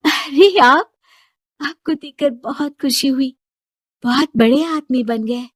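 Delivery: a touch of happy surprise; pleasant surprise. Acted. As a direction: glad to see an old love. Shilpi meets Mukesh again.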